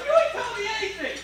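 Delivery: high-pitched mock exasperation; agitated. Coach to the vague stone cutter, agitated. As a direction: act worked up, voice pitched right up. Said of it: "But now I'm getting agitated".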